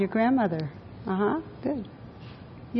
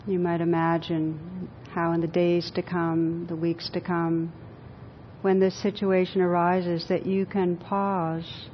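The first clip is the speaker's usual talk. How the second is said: guided meditation; 3 semitones lower, 7 dB quieter than usual. Acted. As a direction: guide them gently inward, unhurried. Leads a guided visualization.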